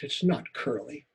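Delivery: plain reading voice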